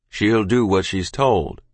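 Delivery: low, slow, firm pronouncement; stern father. A narrator's reading. Father overrules mother, stern. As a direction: lay it down slowly and low.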